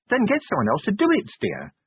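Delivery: high-pitched wife, dropping on "dear"; high, matter-of-fact wife voice; wifely correction. Acted. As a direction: correct your husband lightly, pitch falling at the end. Sybil advises her husband.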